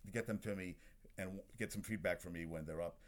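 usual speaking voice

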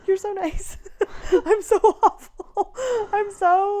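high, loud and slowed, laughing through the words; laughing self-mockery. Real, not acted. Wife mocking herself through laughter, high and loud. Laughing at her own awfulness.

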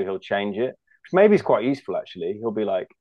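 ordinary talk, nothing marked

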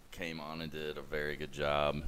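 usual speaking voice

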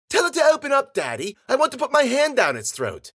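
put-on high, loud child's whine; demanding little girl. A narrator's reading. Bella wanting the captive's jaw opened, demanding loudly. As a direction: badger Daddy like a bratty girl.

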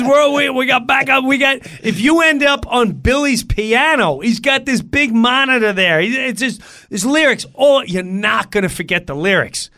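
loud, high Long Island guy; emphatic shouted reassurance from a bandmate. Retold or imitated. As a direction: insist at the top of your voice. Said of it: "Long Island guy".

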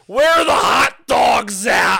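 slow, drawn-out mock yell; mock freak-out. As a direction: imitate a flustered cashier hollering. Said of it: "And she's freaking out".

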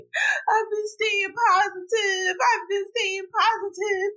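high, tearful wail through sobs; crying. Retold or imitated. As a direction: cry it out, voice breaking high. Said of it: "I was crying 'cause I was just so mad".

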